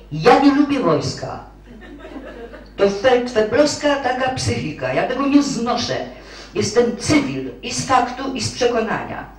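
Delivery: high and loud, digging in her heels; a defiant outburst, high and loud; vehement refusal. Acted. Young clerk declaring to the marshal she hates the army, high and loud.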